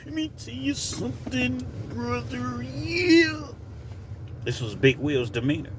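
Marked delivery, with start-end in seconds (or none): 0.1-3.5 high, soft, squeezed wrestler voice; the Hulkster. Retold or imitated. Storyteller proving how Big Will talked, hushed high wrestler impression. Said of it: "That's literally how he talked".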